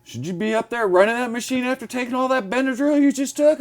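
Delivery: worried boss quizzing him, mild high fuss; high mock concern. Boss doubting he can run the machine, high mocking worry. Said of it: "And he goes".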